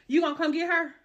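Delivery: demanding he come get her. Retold or imitated. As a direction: raise it the second time. Repeated louder demand.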